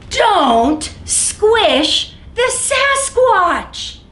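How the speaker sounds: slow, pleading storybook refrain; drawn-out refrain. Acted. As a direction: plead it slowly and sweetly.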